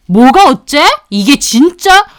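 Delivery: loud indignant yell; enraged boss. Acted. Boss shouting at the worker, furious. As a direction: bark back in outrage.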